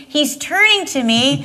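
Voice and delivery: ordinary talk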